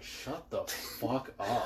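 slow, soft, fed-up mutter; annoyed mutter. Retold or imitated. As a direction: drag it out like you're sick of hearing it. Annoyed by mic clipping at 3am.

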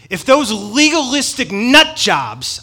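slow, loud, scornful outburst; angry Paul. Retold or imitated. Paul scolding legalists, slow and loud. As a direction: spit it out slowly with scorn. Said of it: "you could hear the anger that's in him".

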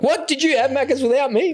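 high mock shocked voice of the family; mock outraged family. Wife and daughter finding the wrappers, mock shocked. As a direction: squeal it like a betrayed wife. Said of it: "they'll be like".

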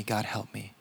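desperate prayer trailing off into near silence; faint plea. Acted. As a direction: let the second plea fade away. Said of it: "This man says".

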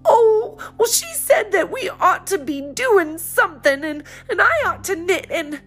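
put-on high girl's voice, half laughing, half crying; laughing through tears. A narrator's reading. Polly telling her mother, laughing through tears. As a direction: gulp the words between sobs.

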